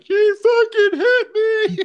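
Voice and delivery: very high, slow, whiny and loud, laughing; girlish tattling. Retold or imitated. Calling dad after the punch, hammed-up girlish whine. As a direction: squeal it like a kid running to dad. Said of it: "the n-, most girlish".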